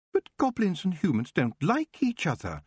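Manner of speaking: genuinely confused; puzzled. Acted. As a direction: elf unable to grasp it.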